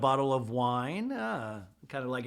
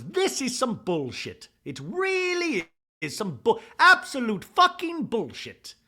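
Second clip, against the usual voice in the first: high, slow building rant; mock outrage; 8 semitones higher, 4 dB louder than usual. [name] fed up in a quarrel, mock outraged yell. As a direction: escalate to a drawn-out imitated yell. Acted outburst in retold quarrel.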